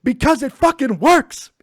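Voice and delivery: loud, high, slow punchline shout; emphatic shout. Retold or imitated. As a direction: burst out with it, drawing out each word. Punchline of acted movie quote.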